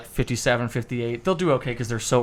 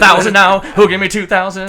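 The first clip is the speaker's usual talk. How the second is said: loud, higher bid calling; auctioneer; 7 semitones higher, 9 dB louder than usual. Shown as a demonstration. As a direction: call for the next bid. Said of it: "And you just keep going like that".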